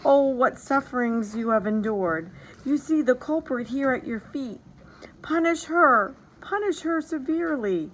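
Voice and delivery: lightly voiced queen begging her husband; pleading queen. A narrator's reading. Queen begs at king's feet.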